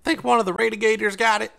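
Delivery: high, loud smirking guess; smirking slaver. Acted. Slaver smirks at his comrades, high and loud. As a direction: toss the question back with a smirk. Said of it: "he smirked".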